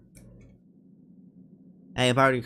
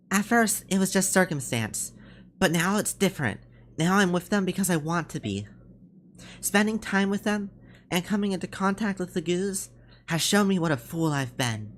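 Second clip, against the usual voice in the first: higher, sincere character voice; earnest young soldier; 7 semitones higher than usual. Acted. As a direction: explain your change of heart to your captain.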